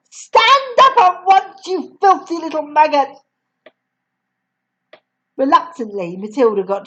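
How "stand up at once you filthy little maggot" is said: higher, louder snarl; angry order. Acted. Headmistress ordering Matilda up, higher and louder. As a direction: spit the insult down.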